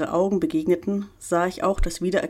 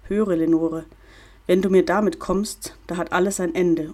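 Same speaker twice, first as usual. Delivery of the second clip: ordinary delivery, nothing marked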